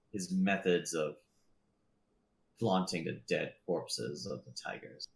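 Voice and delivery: slow, measured lord's speech; measured voice of a ruling lord; stern lord in audience. Acted. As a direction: relay orders slowly, with deliberate pauses.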